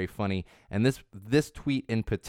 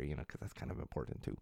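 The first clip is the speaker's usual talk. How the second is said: breathy sotto voce aside; whispered aside; 7 semitones lower, 16 dB quieter than usual. Real, not acted. Host aside on not mistreating people, whispered.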